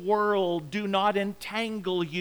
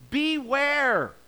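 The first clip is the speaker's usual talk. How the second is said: a raised, higher warning cry; sounding the alarm; 7 semitones higher than usual. Retold or imitated. Preacher voicing Jesus warning the crowd, raised alarm call. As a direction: call out the word Beware like an alarm bell. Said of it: "Here he raises the flag of caution, and he sounds the alarm".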